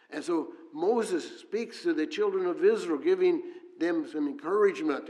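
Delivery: rising fervent sermon delivery; preaching at full force. Acted. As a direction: preach it out to the congregation.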